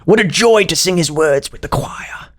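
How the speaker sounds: usual speaking voice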